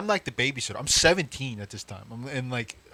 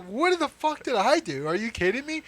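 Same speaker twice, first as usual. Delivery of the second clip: incredulous protest; 12 semitones higher, 3 dB louder than usual. Retold or imitated. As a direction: protest as if wrongly accused. Outraged protest to dad.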